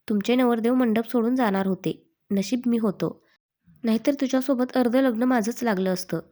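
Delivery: usual speaking voice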